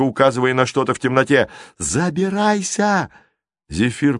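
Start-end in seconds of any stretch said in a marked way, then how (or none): 1.8-3.1 high, drawn-out shouted order; urgent yell. A narrator's reading. Giant orders girl into basket, long high yell. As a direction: call it out slowly at high pitch.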